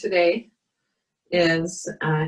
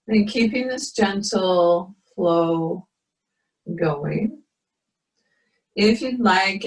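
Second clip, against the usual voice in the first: guided meditation; 2 semitones higher than usual. Acted. Leads a yoga meditation.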